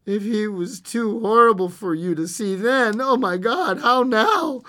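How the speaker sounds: high, rising distress; horrified. Acted. Husband dreads seeing mangled son, horrified. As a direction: plead in mounting horror.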